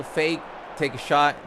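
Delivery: usual speaking voice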